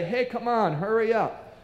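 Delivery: high, hurrying call; mock calling out to a straggler; urging call. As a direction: hurry them along. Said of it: "a place ... to say".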